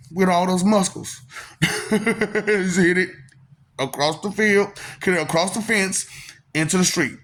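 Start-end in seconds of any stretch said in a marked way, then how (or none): none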